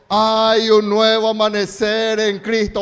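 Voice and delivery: loud, slow preaching call; congregation call-and-response. Real, not acted. Pastor leading the congregation in a loud slow refrain. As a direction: lead the church in a shouted declaration. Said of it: "Diga conmigo".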